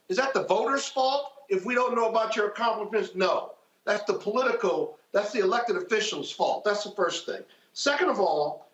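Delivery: ordinary talk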